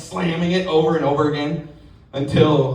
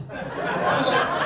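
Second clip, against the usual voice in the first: ordinary talk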